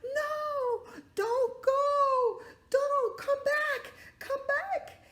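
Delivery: very high, loud desperate begging; pleading shout. Acted. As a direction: beg them to come back. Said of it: "shouting out the window".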